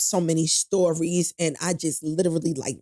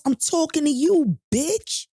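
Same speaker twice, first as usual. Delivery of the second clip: high, mock menacing jab; a retold bully's taunt, raised and louder; imitated threat; 10 semitones higher, 4 dB louder, slower than usual. Man taunting her on the empty job site, mock menacing.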